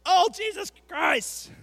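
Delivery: loud, high, drawn-out movie curse; hammed-up slow outburst of swearing; mock exclamation. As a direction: shout it slowly like an exasperated oath. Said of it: "They're like".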